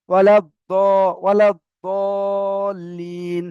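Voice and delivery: "ওয়ালা দলিন" chanted with very long held vowels; drawn-out Quranic "ওয়ালা দলিন"; dad recitation. Shown as a demonstration. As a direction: recite "ওয়ালা দলিন" slowly with a heavy d between z and d. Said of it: "এটা একটু মানে difficult".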